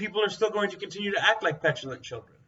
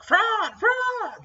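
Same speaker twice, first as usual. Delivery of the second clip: very high mock squawk; parroting squawk; 4 dB louder than usual. Crazy supporters parroting Trump, high squawking mock voice. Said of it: "parroting whatever the parrot is saying".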